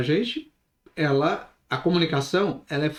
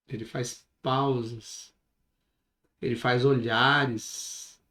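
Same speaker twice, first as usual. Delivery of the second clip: slowed-down delivery; 5 semitones lower, 5 dB quieter than usual. Shown as a demonstration. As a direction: drop the pace and let the pauses hang to build suspense. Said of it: "Vocês perceberam que eu mudei o ritmo?".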